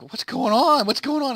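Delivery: mock panic, repeating the question; panicked George. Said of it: "he's like".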